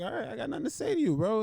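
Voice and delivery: high, quick, easygoing brush-off; imitating Ortega. Fighter brushing off his trash-talking rival, high and breezy. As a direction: shrug him off lightly, bro. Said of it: "Sometimes, like".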